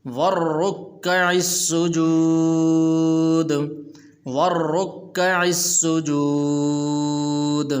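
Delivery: slow sung tajweed recitation; Quran recitation. Shown as a demonstration. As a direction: chant each word long and melodic. (ترتیل کے ساتھ تلاوت)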